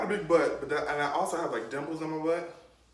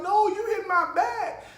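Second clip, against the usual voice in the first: protesting; 6 dB louder than usual. Retold or imitated. Kid protesting during whooping.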